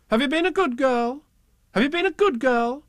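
high, loud sing-song question; mock grown-up sing-song; nagging grown-ups. A narrator's reading. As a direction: nag her in a sing-song. Zoo keepers nagging.

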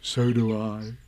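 lightly voiced low bear; a hint of a contented creature; Pooh. A narrator's reading. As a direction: answer low and fond. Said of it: "said Pooh".

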